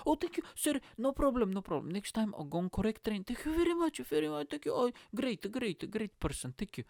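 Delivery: high, effusive fake foreign accent; mock Eastern European accent, over-thankful; pretend foreigner accent. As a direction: gush gratitude as a hapless foreigner with an accent. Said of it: "I was pretending that I just came to the U K".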